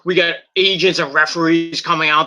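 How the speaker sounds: usual speaking voice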